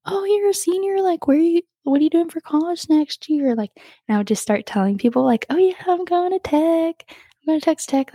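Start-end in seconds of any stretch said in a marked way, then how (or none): 0.1-3.5 imitating a curious stranger, pitched up; lightly voiced nosy small talk, higher; chatty stranger asking. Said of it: "random strangers will ask you". 5.5-8.1 re-enacting an excited announcement, pitched up; high, eager retold brag about college. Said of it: "I got really excited".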